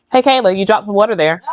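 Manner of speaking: normal talking voice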